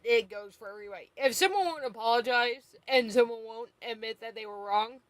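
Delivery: normal talking voice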